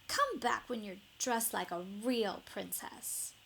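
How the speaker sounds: a touch of a prissy prince looking down on her; snooty prince. A narrator's reading. Ronald scorns Elizabeth's paper bag, lightly voiced haughty prince.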